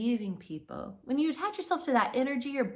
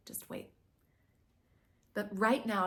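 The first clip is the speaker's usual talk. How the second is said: normal talking voice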